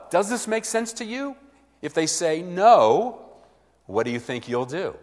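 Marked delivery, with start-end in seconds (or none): 0.1-1.4 bright, higher, louder question; a lifted, cheerful version of the question; upbeat asking. Shown as a demonstration. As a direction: ask it brightly to the listener. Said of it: "You just say to them".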